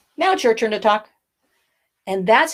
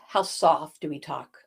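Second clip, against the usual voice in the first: softer and lower, held back; 5 semitones lower, 5 dB quieter than usual. Shown as a demonstration. Voice coach showing pupils soft volume. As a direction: bring it down soft. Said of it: "is volume".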